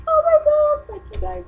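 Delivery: very high, loud squeal easing off; reenacted fangirl squeal. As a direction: squeal at your idols, overwhelmed. Replays squealing at band in car.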